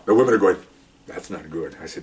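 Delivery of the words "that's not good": low, soft imitation of bystanders; hushed disapproval. Women tell supervisor about insult, hushed disapproval. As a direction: murmur it low and grave.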